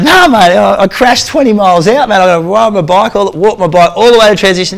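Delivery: high, loud, laughing as he voices the injured rider; laughing quote. Retold or imitated. Quoting the bloodied cyclist, laughing high and loud. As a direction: tell it through laughter. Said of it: "He's like".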